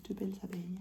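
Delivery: soft fond whisper; whispered aside. Real, not acted. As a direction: hush it like a secret. Whispered aside while showing.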